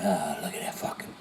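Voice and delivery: hushed, breathy gloating over the bank balance; mock whisper. Mocking a greedy man who hoards his money, in a whisper. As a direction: whisper it like a miser eyeing his account.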